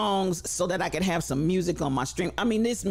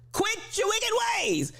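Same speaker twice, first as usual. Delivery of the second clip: mock preacher. Host urging viewers to follow Rebel Ink, hammed-up preacher. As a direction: sing it out like a preacher.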